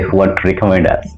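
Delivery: ordinary talk, nothing marked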